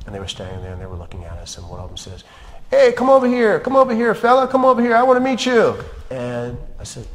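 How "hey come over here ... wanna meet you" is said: loud, high mock call across a field; a stranger beckoning a kid over, retold loudly; calling out a boy.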